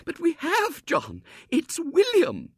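high, slow wail. A narrator's reading. Wife to husband, high slow wailing insistence. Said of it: "wailed Missus Brown".

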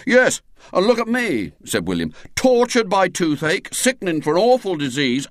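hammed-up martyr of a schoolboy; self-pitying William. A narrator's reading. William rants to his friends, hammed-up tragic.

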